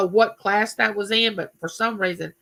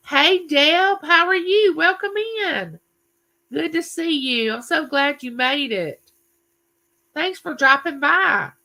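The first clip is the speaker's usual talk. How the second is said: much higher, cheery greeting to an arriving friend; bright welcome; 8 semitones higher than usual. Real, not acted. Host greeting Deb as she drops by, high and cheery. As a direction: welcome her in like a happy host.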